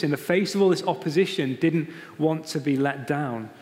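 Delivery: ordinary talk, nothing marked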